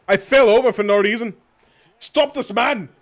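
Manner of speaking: loud, high yell at the game; real high-pitched gamer outburst; exasperated shout. Real, not acted. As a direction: yell in protest at the play. Yelling at the game.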